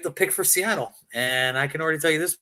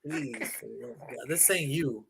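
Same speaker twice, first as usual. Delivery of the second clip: soft mock pleading; 3 semitones lower, 7 dB quieter than usual. Pleading with Wilson.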